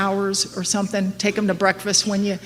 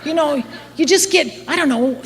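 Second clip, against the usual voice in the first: ordinary talk, nothing marked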